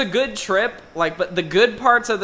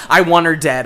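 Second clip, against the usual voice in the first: ordinary delivery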